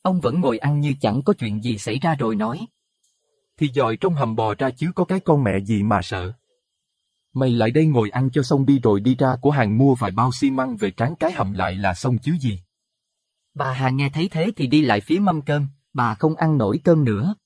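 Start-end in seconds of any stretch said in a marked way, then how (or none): 3.6-12.6 gruff calm husband. A narrator's reading. Husband dismisses wife's panic over maggots, lightly voiced gruff calm. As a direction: brush off her fear coldly. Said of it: "Ông Suy thì có phần bình tĩnh hơn".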